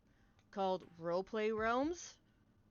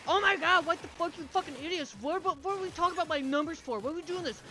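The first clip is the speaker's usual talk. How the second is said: higher, louder mock rant at teammates; imitating angry gamer rant; 6 semitones higher, 5 dB louder than usual. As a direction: mimic him yelling at the screen. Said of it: "I would just hear Mister from, like, the living room".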